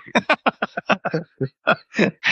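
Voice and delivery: high burst of laughter; amused laugh. Real, not acted. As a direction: laugh at the memory. Laughing at obnoxious officer memory.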